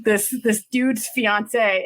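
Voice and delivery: usual speaking voice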